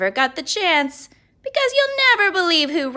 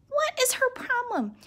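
exasperated; 11 semitones higher, 4 dB quieter than usual. Acted. Narrator's exasperated aside.

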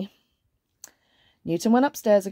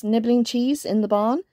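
plain reading voice

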